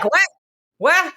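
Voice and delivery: "Ouais" said as a casual "weh"; colloquial French. Retold or imitated. Friend answering the French operator, colloquial "Ouais". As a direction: imitate slangy French "Ouais", open "eh" ending. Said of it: "like, the colloquial thing too".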